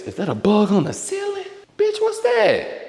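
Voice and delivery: sudden high squeal at a bug on the ceiling; startled shriek. Real, not acted. Spotting a bug on the ceiling, startled high squeal. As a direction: yelp up high, caught off guard.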